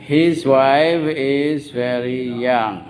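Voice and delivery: very slow, stretched-out classroom drill; repeat-after-me model. Shown as a demonstration. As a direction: draw out every word so learners can follow. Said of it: "Semuanya ulang".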